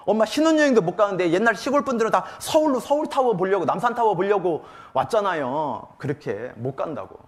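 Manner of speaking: normal talking voice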